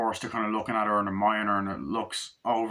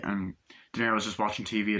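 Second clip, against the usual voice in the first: ordinary talk, nothing marked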